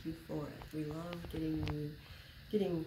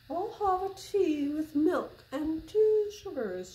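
much higher and louder, slow prim lady ordering; lady customer; 10 semitones higher, 10 dB louder, slower than usual. Acted. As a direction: play the demanding lady customer. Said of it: "she said".

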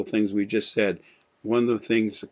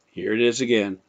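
normal talking voice

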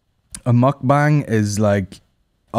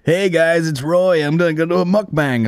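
higher-pitched mock vlogger intro; internet vlogger; 6 semitones higher than usual. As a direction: greet your followers like a generic online creator. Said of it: "like all the rest of the internet".

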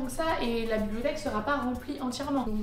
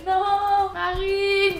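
self-scolding; 7 semitones higher, 6 dB louder, much slower than usual. Real, not acted. Scolds herself for forgetting.

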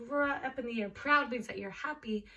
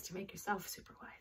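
whisper; 4 semitones lower, 8 dB quieter, faster than usual. Real, not acted. Whispered yoga instruction.

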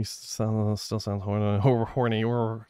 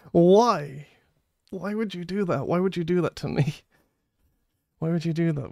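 high protest; 5 semitones higher than usual. Real, not acted. Protesting chat's karaoke demand.

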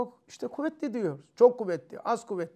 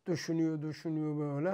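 low, very soft repetition; deep in thought; 6 semitones lower, 12 dB quieter, faster than usual. Shown as a demonstration. Teacher miming someone thinking before acting, hushed and low. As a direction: murmur it as if absorbed in thinking.

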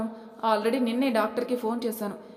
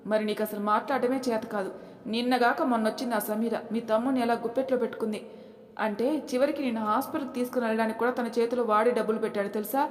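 ordinary delivery

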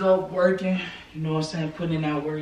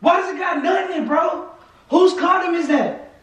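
very high, loud, incredulous questioning; real furious yelling; 4 dB louder than usual. Real, not acted. Boyfriend demanding whose condom that is, furious. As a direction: demand answers in an angry high yell. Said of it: "Why are you going crazy right now?".

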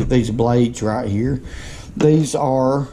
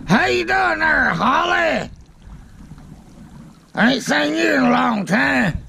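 calling out; 11 semitones higher than usual. Real, not acted. Calling out to passing boaters.

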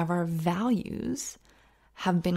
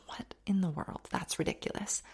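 hushed disbelief under the breath; exasperated son, whispered; 9 dB quieter than usual. Retold or imitated. Son balking at dad's chore, whispered disbelief. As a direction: mutter it like a fed-up kid. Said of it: "the son was like".

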